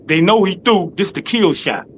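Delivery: ordinary talk, nothing marked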